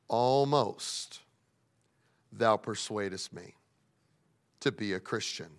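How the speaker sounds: low, soft and slow; hesitant Agrippa. Acted. King Agrippa quietly admits to Paul he is almost persuaded, hushed and halting. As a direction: let the king's words sink away to nothing.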